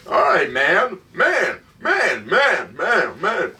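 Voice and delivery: put-on lightly lampoon a doddering old president; Biden impression. Joking about Biden on the podcast, light mock impression. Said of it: "All the fucking guy says is".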